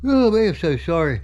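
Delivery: apology still high from the yawn; tired yawned apology. Real, not acted. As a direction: end of a yawn, sorry and sleepy. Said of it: "Don't know why I'm yawning so bad".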